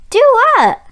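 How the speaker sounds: ask, surprised and out of breath; puzzled. Acted.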